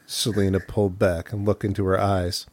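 ordinary delivery

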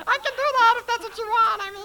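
high, loud, strained put-on voice; other put-on voice. Shown as a demonstration. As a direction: show off a second silly voice. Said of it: "this voice".

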